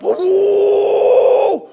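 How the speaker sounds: high, loud startled cry; a sudden shrill wail of alarm; loud mock wail. Truck pump startles family.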